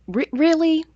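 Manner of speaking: can't believe what she just read; incredulous. Real, not acted. Outraged disbelief at the fanfic.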